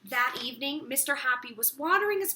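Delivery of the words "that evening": ordinary delivery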